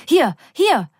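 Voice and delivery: high, urgent call to stop. A narrator's reading. Yellow woman knocking to get out, calling out. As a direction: call out sharply to get the driver's attention. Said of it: "ruft die gelbe Frau".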